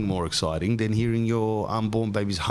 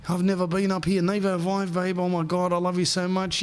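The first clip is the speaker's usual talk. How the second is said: higher swooning lovers voice; lovey couple; 10 semitones higher than usual. Retold or imitated. Couple kissing up top, higher mock swoon. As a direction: gush it like mock lovebirds. Couple on Empire State Building.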